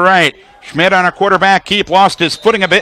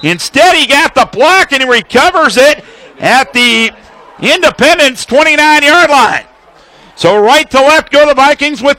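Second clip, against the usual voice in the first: excited call; 7 semitones higher than usual. Real, not acted. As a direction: burst out as the punt gets blocked. Blocked punt, live call.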